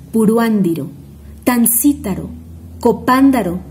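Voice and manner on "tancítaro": ordinary delivery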